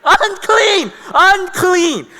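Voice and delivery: high, loud repeated shout; warning yell. Acted. Townspeople warning that a leper is out in public, yelling. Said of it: "people needed to yell".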